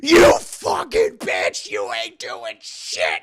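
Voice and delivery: slow, loud, dragged-out insult; snarling doll. Retold or imitated. Possessed doll curses the mother, slow snarl. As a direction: spit it out like a nasty little killer.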